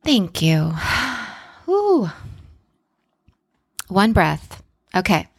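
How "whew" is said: long sigh out, then a high whew; relieved sigh. Real, not acted. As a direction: breathe out with relief. After the shared breath.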